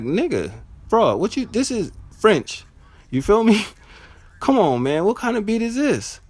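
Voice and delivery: high, pleading disbelief; exasperated protest. Retold or imitated. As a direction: protest like you're let down by a friend. Acting out protest at the beat.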